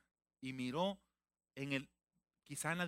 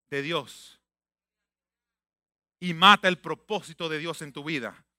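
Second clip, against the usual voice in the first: loud, forceful sermon delivery; preaching; 12 dB louder than usual. Acted. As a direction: hammer the warning like a preacher. (predicación fuerte)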